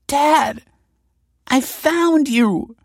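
high, slow, tearful accusation; weeping. A narrator's reading. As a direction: daughter confronting her father in tears. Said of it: "wept Olive".

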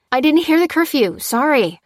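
high, loud call of surrender; called-out apology. A narrator's reading. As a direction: call it out hastily to someone at a distance. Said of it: "called Lucy".

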